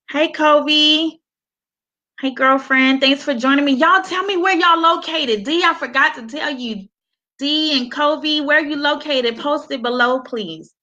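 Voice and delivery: high, bright shout-outs to viewers; excited greeting. Real, not acted. Livestream host greeting viewers, excited. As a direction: welcome each viewer warmly and eagerly.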